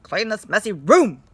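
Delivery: mother insisting firmly; insisted. Shown as a demonstration. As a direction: press it, no arguing. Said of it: "insisted Mother".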